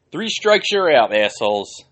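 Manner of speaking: high, loud taunting call; mocking shout. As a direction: yell it out like an umpire mocking them. Mocking chant at offenders.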